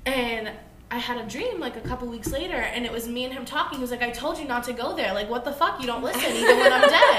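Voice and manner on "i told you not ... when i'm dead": mild mock yell. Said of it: "he's yelling at me from the dead".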